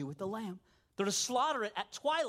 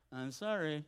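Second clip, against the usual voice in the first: lower, grudging sorry; insincere apology; 5 semitones lower, slower than usual. Retold or imitated. Kid forced by mom to apologize to sister, insincere. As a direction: mumble it like you don't mean it. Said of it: "the most insincere apology imaginable".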